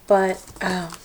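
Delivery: ordinary talk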